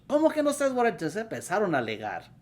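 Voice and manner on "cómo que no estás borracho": high, loud mock outrage; indignant retort. Wife disputing her husband's denial of drinking, acted indignant retort. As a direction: fire back in an acted, incredulous high voice. Acted quarrel, couple starts arguing.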